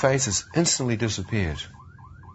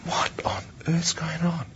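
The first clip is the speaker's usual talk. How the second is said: hushed, higher, astonished whisper; awed whisper; 7 semitones higher, 3 dB quieter than usual. Retold or imitated. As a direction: whisper it as if the world just shifted. Inner thought, awed by visions.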